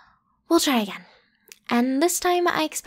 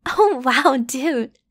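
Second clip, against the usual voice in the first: startled wow; 6 dB louder, much slower than usual. Acted. Surprised by partner's move.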